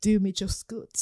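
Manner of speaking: playful Geordie swagger; a hint of a Love Island Geordie flirting; mock Geordie accent. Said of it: "we have to do that accent way more".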